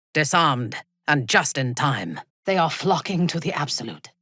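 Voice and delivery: ordinary talk, nothing marked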